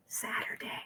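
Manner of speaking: hushed whisper so nobody hears. Real, not acted. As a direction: whisper it, voiceless. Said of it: "so I have to whisper".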